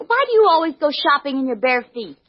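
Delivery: ordinary delivery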